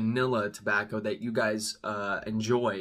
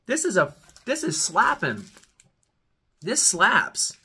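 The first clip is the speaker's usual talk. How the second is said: high, loud delighted outburst; excited; 7 semitones higher, 4 dB louder than usual. Real, not acted. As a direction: burst out thrilled by the taste. Excited by the flavor.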